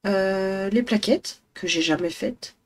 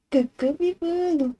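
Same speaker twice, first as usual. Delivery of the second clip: silly pet voice; 8 semitones higher, slower than usual. Real, not acted. Woman greeting her cat Biboune in silly baby talk. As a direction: stretch it out sweetly for the cat. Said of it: "des voix débiles quand on parle à nos animaux".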